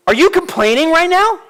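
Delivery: high, loud mock-stern mom; Stephanie scolding. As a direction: sternly call out a complaining kid. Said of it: "I can just hear her say it".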